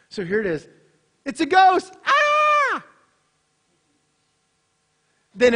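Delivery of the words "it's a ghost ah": high, loud cartoon shriek; mock scream. Preacher acting the disciples' frightened scream, much higher. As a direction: squeal it like a spooked cartoon.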